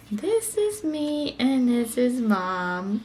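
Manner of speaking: slow, soft read-aloud; soft slow storybook reading. Real, not acted. Reading picture book to toddler.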